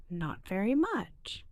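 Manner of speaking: ordinary delivery, nothing marked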